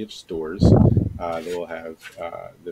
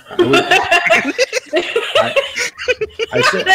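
laughing; 12 dB louder than usual. Real, not acted. GM cracking up over chat.

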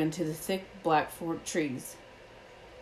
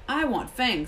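usual speaking voice

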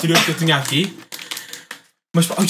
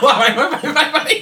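high laughing urging; laughing shout; 8 semitones higher, 4 dB louder, much slower than usual. Real, not acted. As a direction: egg him on laughing.

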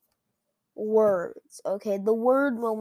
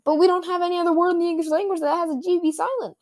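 high, fast, louder objection; incredulous protest; 8 semitones higher, 5 dB louder, faster than usual. Acted. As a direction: blurt out a high-pitched, rushed protest. Acted objection in council quarrel.

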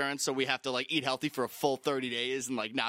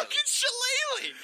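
laughing; 3 dB louder than usual. Real, not acted. Laughing at the word.

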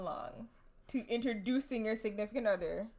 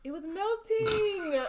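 high, drawn-out whine of excuse; slow, high-pitched defensive wail with a laugh; laughing protest; 9 semitones higher, much slower than usual. Real, not acted. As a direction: protest your innocence, stretching the words. Protesting teasing about cutting cake.

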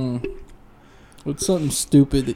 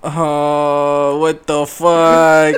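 disbelieving; 7 dB louder, much slower than usual. Real, not acted. Disbelief at the unicycle.

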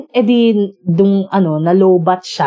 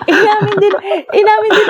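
laughing; 8 semitones higher than usual. Real, not acted. Laughing while retelling confession.

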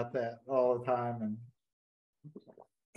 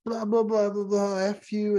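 mock angry cussing; 10 semitones higher than usual. Cussing out the master chief over a gross job, high mock rant. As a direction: rattle off the insults in a squeaky, hammed-up rant. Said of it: "Then I just start cussing him out".

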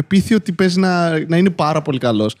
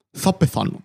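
«Θα πεθάνω» repeated softer, trailing away as if expiring; mock dying sigh of «Θα πεθάνω»; fading mock death; 6 dB quieter, slower than usual. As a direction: let it die off quietly. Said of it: "Είναι πιο drama queen".

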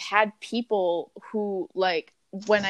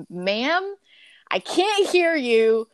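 slow, raised-pitch begging at a customer; exasperated plea; 3 semitones higher, slower than usual. Retold or imitated. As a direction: plead with strained patience, drawing out the words. Acted plea to phone customer.

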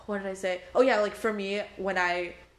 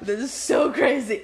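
louder, amazed exclamation; exclaiming; 3 semitones higher, 6 dB louder than usual. Real, not acted. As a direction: throw it out in disbelief. Reacting to the story.

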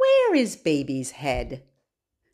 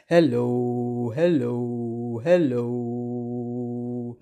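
very low, drawn-out hellos; as low as possible; 6 semitones lower, much slower than usual. Shown as a demonstration. Greeting babies as low as we can, deep voice. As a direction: sink to your deepest note and hold each one. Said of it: "as low as we can".

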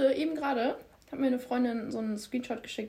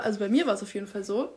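ordinary talk, nothing marked

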